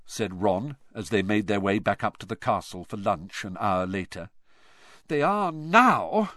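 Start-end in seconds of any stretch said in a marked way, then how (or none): 5.1-6.3 slow, louder, fed up; exasperated. A narrator's reading. Hermione answers Ron, exasperated and slow. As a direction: sigh it out, exasperated. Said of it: "in an exasperated voice".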